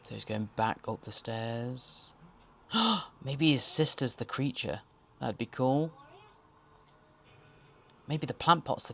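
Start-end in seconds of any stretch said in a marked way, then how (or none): 2.7-3.2 high, quick intake of breath; sharp gasp. Real, not acted. Host guessing the sister is the creature, gasp. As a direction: gasp at a sudden idea. Sudden idea about the creature.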